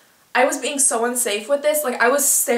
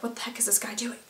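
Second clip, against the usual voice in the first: embarrassed aside; 12 dB quieter, faster than usual. Retold or imitated. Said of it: "obviously was embarrassed".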